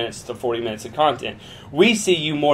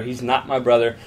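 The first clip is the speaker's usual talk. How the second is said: ordinary talk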